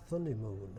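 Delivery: "Thunnymoon" with th for t before a vowel; Holmfirth th. Shown as a demonstration. Dialect chairman reading a Holmfirth spelling, th sound. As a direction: say it like the old Holmfirth writer. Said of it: "they pronounce it as th".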